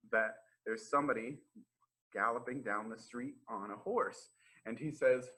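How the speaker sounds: ordinary delivery, nothing marked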